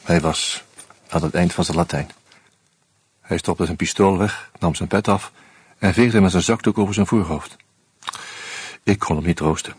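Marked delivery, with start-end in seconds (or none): none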